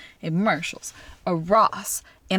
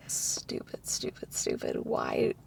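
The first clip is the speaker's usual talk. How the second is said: hushed, unvoiced scolding of herself; whispered self-reproach; 10 dB quieter, slower than usual. Woman berating herself over a careless mistake, whispered self-reproach. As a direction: whisper it like kicking yourself.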